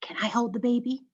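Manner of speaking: soft, gentle question; asking softly. Acted. Someone asking to hold the baby, soft question. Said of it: "I asked".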